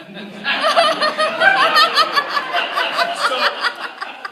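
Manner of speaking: very high, loud laughing fit; can't stop laughing; real laughter; shrieking laughter. Real, not acted. Laughs at mother story.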